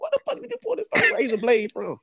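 confronting her about the razor blade; incredulous outrage. Retold or imitated. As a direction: demand it high in disbelief. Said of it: "I'm like".

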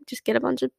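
ordinary talk

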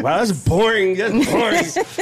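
hammed-up letdown, slowing at the end; mock disappointment. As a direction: tease with exaggerated letdown. Acting his reaction to dull story.

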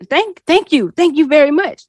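hint of a shy learner; mock careful, eager thanks; halting foreign thanks. Learner thanks her in English, lightly voiced eager thanks.

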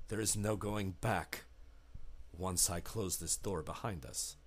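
low, hushed ominous host voice; a sinister butler warning the guests; low menacing innkeeper, quiet and final. Acted.

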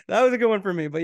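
ordinary talk

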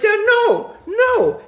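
loud, very high reenacted protest; frantic disbelief. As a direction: refuse to believe it, at the top of your pitch. Reenacts protesting the wrong answer.

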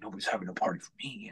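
whispered, hushed grumble; mock whisper. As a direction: imitate the boss muttering under his breath. Boss complaining, voiced by teller.

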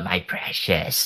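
slow, hushed creepy creature whisper; Gollum voice. Shown as a demonstration. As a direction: croon it like a hunched cave creature. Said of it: "you said this in the Golem voice".

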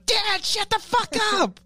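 high, slow mock yell; woken son snapping. Acting the son woken by drunk dad.

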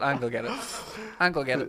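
ordinary delivery

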